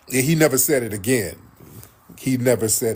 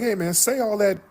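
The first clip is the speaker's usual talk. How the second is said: higher mock challenge, lightly voiced; imitating Corey; 8 semitones higher than usual. Retelling Corey daring Anton to repeat it, mock challenge. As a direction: dare him to repeat it, hammed up. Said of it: "It was like".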